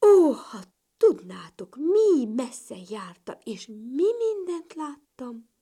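loud, slow, drawn-out wonder; very excited. A narrator's reading. Little ladybird telling her family of her travels, excited. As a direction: stretch the words out in excited amazement. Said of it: "nagy izgatottan mesélni kezdett".

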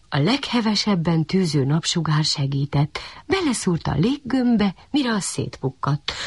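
plain reading voice

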